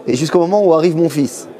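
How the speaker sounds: ordinary delivery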